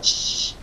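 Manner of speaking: soft hissing shh like a rattle; rattle hiss imitation. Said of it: "it was so loud".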